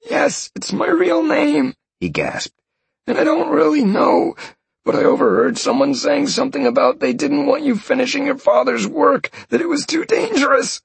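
high pleading voice of a beaten man; terrified captive. Acted. Captured mage swearing to Sean he killed nobody, high and pleading. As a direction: beg him in a high panicked voice.

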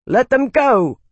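high, loud shout. A narrator's reading. Isana shouting to let Cord's men go, loud. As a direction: ring it out to stop them. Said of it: "shouted Isana".

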